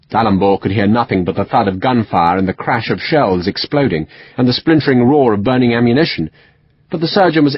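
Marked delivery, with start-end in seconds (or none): none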